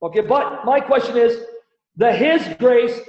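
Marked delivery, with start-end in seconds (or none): 2.0-3.0 "his" slowed and lifted in "the his grace"; slow pointed stress on "his" with a rise. Shown as a demonstration. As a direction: point hard at "his", slowly. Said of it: "the his refers to who?".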